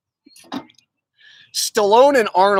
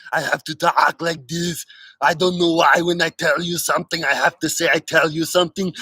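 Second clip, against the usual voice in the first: a light Austrian-accented Arnold ramble; Arnold impression. Shown as a demonstration. Showing how anyone can do Arnold, light Austrian accent. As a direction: ham up an Austrian bodybuilder. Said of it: "anyone can be like".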